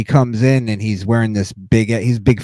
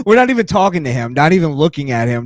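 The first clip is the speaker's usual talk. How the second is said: ordinary delivery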